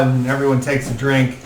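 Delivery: ordinary talk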